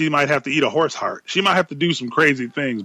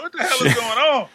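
surprised; 11 semitones higher than usual. Retold or imitated. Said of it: "I was like".

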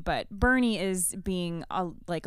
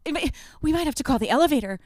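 a hint of a higher, louder fast old lady; flustered librarian; 6 semitones higher, 6 dB louder, faster than usual. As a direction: rush it like a panicked librarian.

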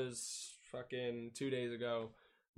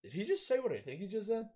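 shocked disbelief; 9 semitones higher than usual. Retold or imitated. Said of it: "he's like".

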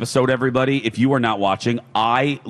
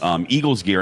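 normal talking voice

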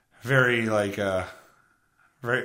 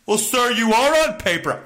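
mock agent voice; 7 semitones higher, 4 dB louder than usual. Imitating the customer service agent.

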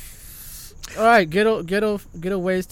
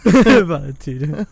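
mocking echo; 10 dB louder, much slower than usual. Mocking the knockoff brand name.

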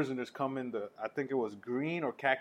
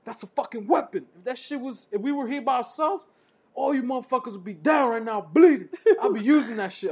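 loud, much higher ranting yell; angry inmate speech; 9 semitones higher, 6 dB louder than usual. Retold or imitated. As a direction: scream at the scared kids. Inmate's scare speech continues.

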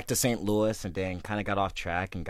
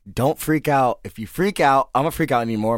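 loud, urgent plea to mom; begging her not to panic, loudly; panicked plea; 5 semitones higher, 9 dB louder than usual. Retold or imitated. Son waking mom in the night, urgent loud plea.

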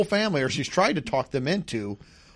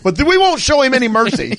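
mock defiant declaration; 9 semitones higher, 8 dB louder than usual. Mock retaliation against kangaroo.

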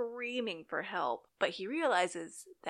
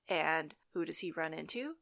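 ordinary delivery, nothing marked

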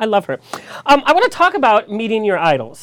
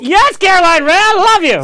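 excited shout; 10 semitones higher, 10 dB louder than usual. Real, not acted. Host gushes to star on phone.

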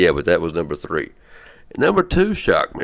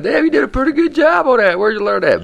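loud, much higher imagined listener; mock amazed praise; 6 dB louder than usual. Host imagining fans praising his accent, mock amazed. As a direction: exclaim in hammed-up admiration.

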